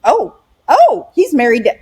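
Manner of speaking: high, halting "oh" of discovery; dawning realization. Retold or imitated. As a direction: let the penny drop in rising, broken starts. Acting out her sudden realization.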